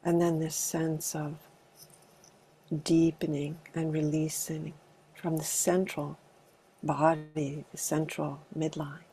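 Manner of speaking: calm, unhurried meditation guidance with long pauses; spacious meditation leader's delivery; guided meditation. Acted. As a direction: gently guide a group into arriving, leaving space between phrases. Leads a body meditation.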